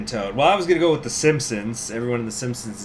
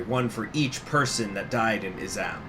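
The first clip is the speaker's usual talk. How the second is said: ordinary delivery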